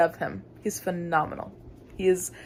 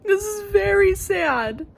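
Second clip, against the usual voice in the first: high, loud, slow wailing lament; mock wail; 12 semitones higher, 7 dB louder than usual. Real, not acted. Upset reader to camera, hammed-up wail. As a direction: wail it like a dramatic mourner. Reacting to a sad plot twist.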